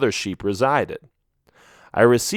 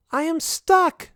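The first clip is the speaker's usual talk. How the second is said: very high, slow sheep voice; a small trapped sheep calling for help; stuck sheep; slower than usual. A narrator's reading. Trapped sheep calling to her rescuer, very high. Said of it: "she said".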